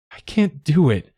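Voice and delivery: high, loud tearful cry; croaking through tears. A narrator's reading. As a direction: choke it out after crying. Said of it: "I croaked at last".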